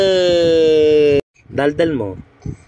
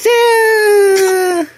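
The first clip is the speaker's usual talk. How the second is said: short squeal, much higher; high playful squeal; 5 dB louder than usual. Acted. Mother squealing playfully to her baby.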